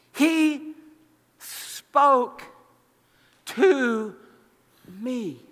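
"He... spoke... to... me", each word set apart by a pause; emphatic wonder. Acted.

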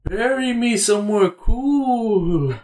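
high, slow spooky ghost; ghostly voice. Pretend. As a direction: plead like a ghost from beyond. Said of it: "I'm hearing a ghostly voice".

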